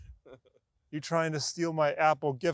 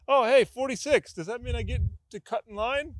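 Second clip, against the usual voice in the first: higher, cheerful mock voice of a fool in line; mock DMV idiot; 7 semitones higher than usual. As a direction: play the eager idiot hoping to skip the line. Mocking DMV head-smasher.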